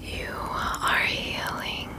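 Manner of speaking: ordinary delivery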